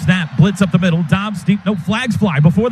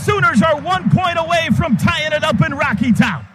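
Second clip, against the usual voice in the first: touchdown call; 8 semitones higher than usual. Real, not acted. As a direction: stretch the name out in triumph.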